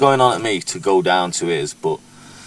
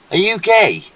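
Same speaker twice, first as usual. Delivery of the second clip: higher, louder mocking jab; mocking taunt; 8 semitones higher, 4 dB louder, slower than usual. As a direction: sneer it like a teasing girl. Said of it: "Whereas you go like".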